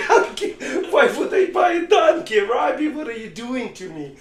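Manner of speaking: high, loud disbelieving yell; outraged shout. Acted. Poor man yelling at the rabbi over buying a donkey, outraged shout. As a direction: shout it in shock at the absurd advice.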